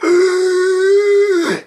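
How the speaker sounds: high, loud pained gasp. Retold or imitated. Infected colonist gasping in pain, high pained gasp. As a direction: gasp like someone dying. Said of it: "she was all like".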